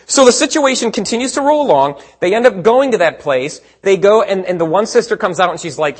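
ordinary talk, nothing marked

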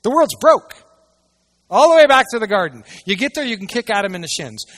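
loud, high preacher's declaration; fiery preaching. Acted. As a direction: thunder it from the pulpit. Preaching at full force.

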